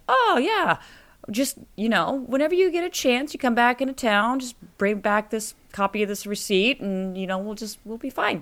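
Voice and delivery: high folksy reassurance, no worries; trusting store owner. Retold or imitated. Store owner voiced again.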